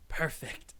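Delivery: hushed satisfied "Perfect."; soft echo. Acted. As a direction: let "Perfect." slip out quietly, almost to yourself. Lover echoes the word softly.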